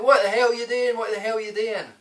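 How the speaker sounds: high, loud imitation of a shouting woman; mock shouting. Flower shop woman yelling at him after the headbutt, mock shout. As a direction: mimic her outraged shout, pitched right up. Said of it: "she starts shouting at me".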